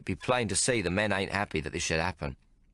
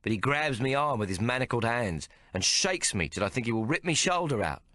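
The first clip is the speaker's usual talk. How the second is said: plain reading voice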